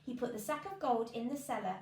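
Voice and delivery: plain reading voice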